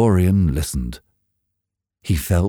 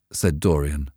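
ordinary delivery

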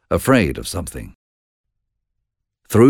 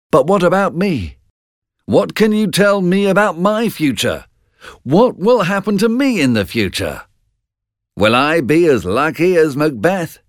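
high, eager demand to know; bold questioning; 11 semitones higher than usual. A narrator's reading. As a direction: press them boldly for an answer. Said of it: "he said boldly".

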